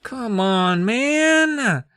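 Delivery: exasperated voice. Retold or imitated. Mocking the kid.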